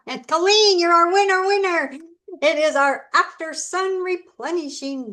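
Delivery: high, loud winner announcement; excited announcement. Real, not acted. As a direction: call out the winner with glee.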